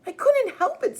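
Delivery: put-on little kid whining his excuse; whiny little brother to mom. A narrator's reading. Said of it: "kind of whining".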